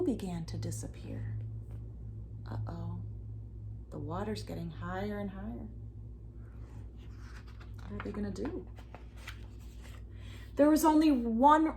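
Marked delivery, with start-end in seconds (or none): 0.0-8.0 whisper. A narrator's reading. Suspense narration.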